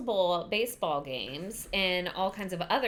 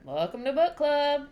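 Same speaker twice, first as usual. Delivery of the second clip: loud, lifted shop-style welcome; Welcome to Moe's greeting; 7 semitones higher, 5 dB louder than usual. Acted. Host opening book club like a Moe's greeting, sing-out welcome. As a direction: call it out like a fast-food greeter. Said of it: "Did that sound like Welcome to Moe's?".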